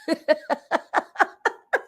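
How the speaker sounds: long high giggling fit; real laughter. Real, not acted. Reader amused by the spirit's accent, helpless laughter. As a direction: crack up in high, rapid giggles.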